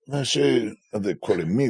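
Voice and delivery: normal talking voice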